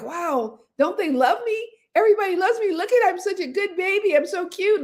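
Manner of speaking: put-on high, delighted baby voice sure everyone loves it; proud baby. Showing the audience a newborn's view of itself, high and delighted. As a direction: play a newborn who thinks it's adorable. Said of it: "When we're first born, we think".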